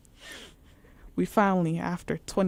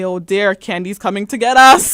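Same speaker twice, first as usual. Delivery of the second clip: louder, panicked announcement; alarmed outburst; 2 semitones higher, 15 dB louder than usual. Retold or imitated. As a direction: blurt it out in mock horror.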